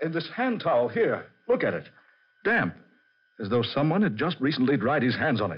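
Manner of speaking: high, urgent, spiking on "Damp"; alarmed discovery; nervous realization; creeping fear. Acted. Discovers the towel is still damp.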